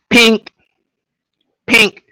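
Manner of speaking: high, punchy "pink" for each shot; short loud pings, spaced apart; imitated gunshots. Retelling Joel taking them out, voicing gunshots.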